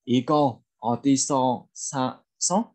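slow Korean, broken into chunks; slow pronunciation model. Shown as a demonstration. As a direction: lay out each syllable for learners. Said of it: "tiếng Hàn sẽ đọc là". (đọc mẫu chậm từng cụm)

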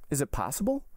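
wide-eyed, guileless surprise; feigned simple candor. A narrator's reading. Count innocently questions the banker, feigned candor. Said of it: "assuming all air and tone of the utmost simplicity and candor".

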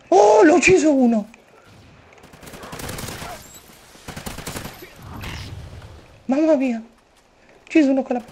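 high, loud thrilled shout; excited yell. Real, not acted. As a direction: yell it out after a kill. Excited kill celebration.

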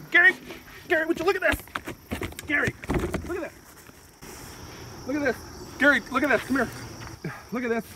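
high eager calling; excited calling. Acted. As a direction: beg Gary to come look. Calling Gary over again.

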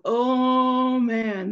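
loud, drawn-out delighted "oh, man"; hungry cat. Acted. Cat spotting two little mice at lunchtime, hungry delight. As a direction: savour the sight of an easy snack.

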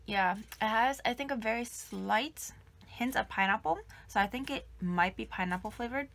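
chewy candy in mouth; talking while chewing. Real, not acted. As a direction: mouth partly full of jelly. Seen 0: Bites jelly tube before and at 6.8s; chewing motions between phrases.

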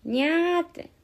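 high, drawn-out Shinjo "nyaa"; cat-like Shinjo dialect ending. Shown as a demonstration. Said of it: "新庄弁？".

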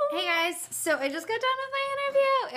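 high, giddy announcement; real relief bursting out high; excited. Real, not acted. Announces the interview is over.

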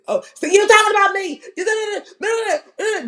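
very high, babbling mimicry; mocking panel. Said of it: "they was just".

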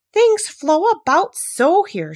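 high, loud, slow complaint; drawn-out plaintive cry. A narrator's reading. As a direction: wail it plaintively. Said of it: "in a plaintive tone".

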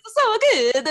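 high, giggling mock Russian; fake Russian accent. Woman demonstrating her act for movers, laughing fake Russian. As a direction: attempt a silly Russian accent while laughing. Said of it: "doing my fake Russian accent".

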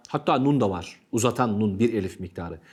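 normal talking voice

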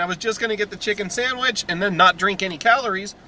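ordinary delivery

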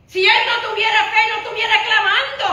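loud, high preaching line; emphatic preaching. Acted. Pastor stressing the blind man's faith, loud emphatic preaching. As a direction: drive the point home to the congregation. First of two takes.

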